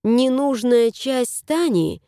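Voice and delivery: plain reading voice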